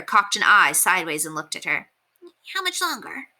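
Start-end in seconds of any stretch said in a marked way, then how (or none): 2.5-3.3 higher, softer bird voice barely opening its beak; a lightly voiced owl talking without moving; through a half-open beak. A narrator's reading. Bird posing for Prue's sketch, squeezing words through its beak. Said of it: "He managed through a half-open beak".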